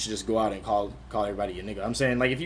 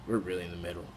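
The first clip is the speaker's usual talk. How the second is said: said again, much softer and lower; soft reflective echo; softer repeat; 5 semitones lower, 9 dB quieter, faster than usual. Real, not acted. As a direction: let the repeat sink in quietly.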